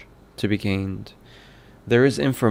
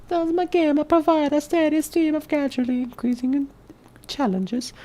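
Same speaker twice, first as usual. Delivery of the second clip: high comic Italian reading voice; Italian accent. Acted. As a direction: keep the hammed-up Italian accent going. Italian voice for lens question.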